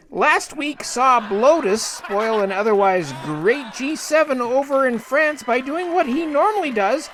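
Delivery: normal talking voice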